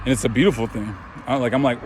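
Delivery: ordinary talk, nothing marked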